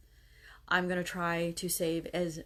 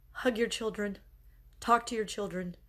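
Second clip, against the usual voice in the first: usual speaking voice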